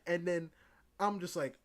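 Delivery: ordinary talk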